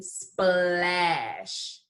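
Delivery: SPLASH stretched out, every sound held; slow-motion splash for sounding out; said slowly. Shown as a demonstration. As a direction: draw out splash through sp, l, a, sh. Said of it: "I'm gonna say it slowly".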